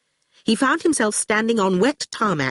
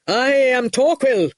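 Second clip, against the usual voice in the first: slow, proud, raised proclamation; cried out; 6 semitones higher, 4 dB louder, slower than usual. A narrator's reading. Torquil announces himself to the orchestra, proud slow cry. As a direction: announce yourself grandly. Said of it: "he cried".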